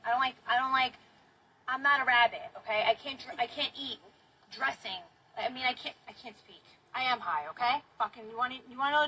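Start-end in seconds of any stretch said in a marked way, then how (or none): none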